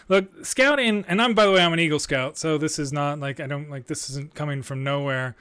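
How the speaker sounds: ordinary talk, nothing marked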